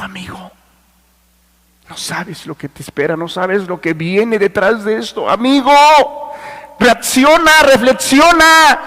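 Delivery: hushed start building to a high, loud cry; earnest warning that swells into an urgent call; sincere plea. Acted. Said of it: "Fue sincero".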